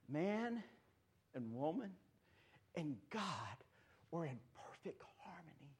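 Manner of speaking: breathy hushed whisper. Acted. Pastor hushing the congregation, a sudden whisper. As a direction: drop to a secret hush. Drops to hush mid-sermon.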